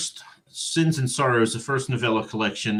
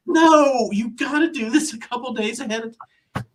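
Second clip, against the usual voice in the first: mock self-scolding; 10 semitones higher than usual. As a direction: berate yourself in a burst. Scolding himself in retold thought.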